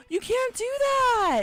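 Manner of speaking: high, slow, drawn-out indignation; mock outrage. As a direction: protest in hammed-up, high-pitched horror. Mock outrage at rule breaking.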